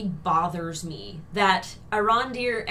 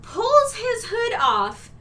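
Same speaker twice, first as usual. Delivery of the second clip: ordinary talk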